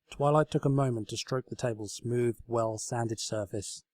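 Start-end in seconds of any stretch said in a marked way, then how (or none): none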